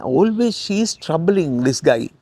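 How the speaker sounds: usual speaking voice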